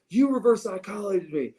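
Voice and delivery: high, exasperated dad imitation; frustrated dad. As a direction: accuse like a father who's been tricked. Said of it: "He is so frustrated".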